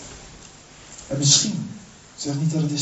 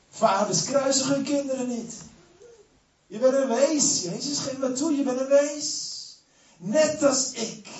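high, taunting sneer of the devil; Satan mocking; 12 semitones higher than usual. Satan taunts the crucified Jesus, high mocking voice. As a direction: jeer at him like the fallen angel.

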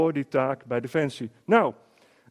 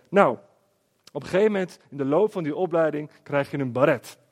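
ordinary talk, nothing marked

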